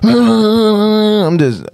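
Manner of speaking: high, slow words through a laugh. Real, not acted. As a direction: laugh it out, a bit lost and amused. Laughing at his own confusion.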